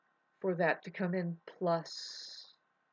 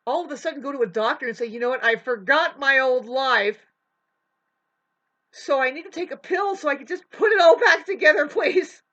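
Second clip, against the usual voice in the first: much higher, louder pleading to the doctor; mock desperate plea; 5 semitones higher, 10 dB louder than usual. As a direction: beg the doctor, hammed-up and loud.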